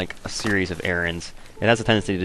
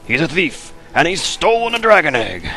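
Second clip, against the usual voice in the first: raised, shouty quote of the line; quoted Spyro line; 10 semitones higher, 7 dB louder than usual. Retold or imitated. Quoting a favourite game line, loud and high. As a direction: ham up the alarmed cry. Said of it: "I love that line".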